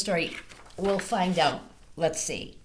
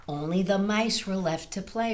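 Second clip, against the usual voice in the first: ordinary delivery, nothing marked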